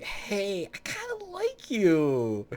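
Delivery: high, drawn-out sweet-talk; mock friendly character. Game character telling you they like you, mock affectionate. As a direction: stretch it out high in a put-on friendly voice.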